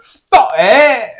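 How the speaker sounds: high, loud British mimicry through laughter; mock British accent. Man parrots his partner, laughing mock British accent. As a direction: squeal it in a teasing British accent. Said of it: "hear how she just said that".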